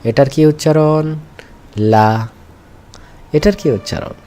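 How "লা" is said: লা said short, with no drawn-out vowel; short, unstretched. Shown as a demonstration. Teacher models direct pronunciation for students, unstretched. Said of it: "এখন কিন্তু আর টানা যাবে না".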